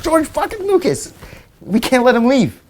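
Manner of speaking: very high, frantic whisper-shout of excitement; star-struck squeal. Retold or imitated. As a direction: squeal it like a fan who can't believe it. Urging Jamie, star-struck.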